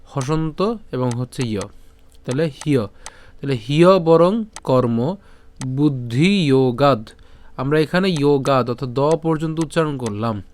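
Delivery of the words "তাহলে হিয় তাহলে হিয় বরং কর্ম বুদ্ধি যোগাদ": হিয় said as hi-yo, the জফলা sounded like ইয়; slow, careful হিয় বরং কর্ম with the ya sound; Sanskrit pronunciation. Shown as a demonstration. Teacher showing students the জফলা sound in হিয়. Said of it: "জফলা উচ্চারণ কার মতো হবে?".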